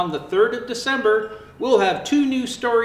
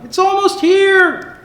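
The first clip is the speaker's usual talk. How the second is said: much higher, louder, slower squeal; excited animal friend; 13 semitones higher, 9 dB louder, slower than usual. Acted. Zoo friend telling Fiona Christmas is near, excitedly. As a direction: squeal it with delight.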